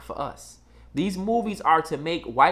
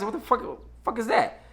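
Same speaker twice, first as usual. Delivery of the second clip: shocked; 6 semitones higher, 4 dB quieter than usual. Retold or imitated. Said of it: "that's why I said".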